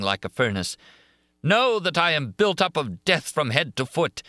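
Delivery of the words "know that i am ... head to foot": high, impassioned declaration; shouted. A narrator's reading. Monster declaring himself made of death, shouted. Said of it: "he shouted, with his throat throbbed and panting".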